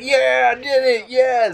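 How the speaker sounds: high, happy; imitating a relieved win; mock proud yeah; relieved triumph. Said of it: "he's like".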